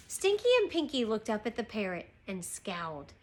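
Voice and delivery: usual speaking voice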